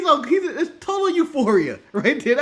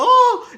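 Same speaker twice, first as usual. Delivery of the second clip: high, loud imitated "oh!"; sudden commentator's whoop, put on; Jerry the King Lawler; 12 semitones higher, 8 dB louder than usual. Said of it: "You need him going".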